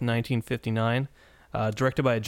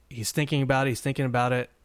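normal talking voice

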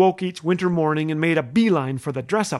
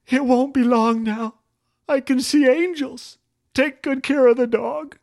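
weak little voice; 5 semitones higher than usual. Retold or imitated. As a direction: fake dying bravely, weakly. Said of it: "I would say in a weak little voice".

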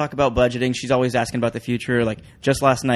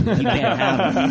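high, slow, laughing; 8 semitones higher, much slower than usual. Real, not acted. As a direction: giggle through the line. Jokes while laughing.

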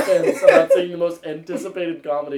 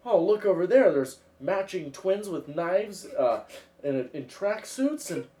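mock casual Owen Wilson; 6 semitones higher than usual. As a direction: point things out like a dazed stoner. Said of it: "he goes".

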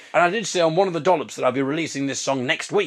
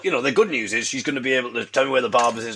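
ordinary talk

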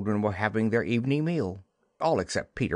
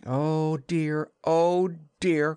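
exasperated cry; 6 semitones higher, much slower than usual. Acted. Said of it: "cried Wendy".

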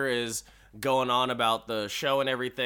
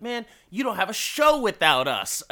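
high, indignant retort; bristling; 8 semitones higher, 4 dB louder than usual. Acted. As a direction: push back at the boss, affronted. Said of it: "Sickles gets all bristled about this".

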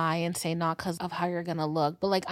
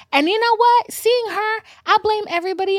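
exclaiming; 13 semitones higher, 8 dB louder than usual. Real, not acted. Exclaims emphatically.

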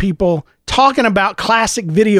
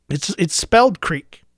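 ordinary talk

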